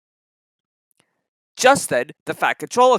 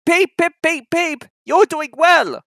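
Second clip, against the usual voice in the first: much higher, louder whistle; whistling cheer; 11 semitones higher, 6 dB louder than usual. Acted. Edward cheering James up the hill, higher and slow. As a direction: whistle encouragement. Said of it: "whistled Edward".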